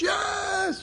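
high, shouted eager yes; mock the eager churchgoer's cheer. Mimicking the church crowd's eager shouted answer.

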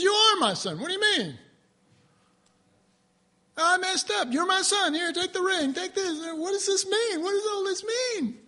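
lifted, high-pitched storytelling voices of father and son. Acted. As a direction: voice the reunion up high, eager.

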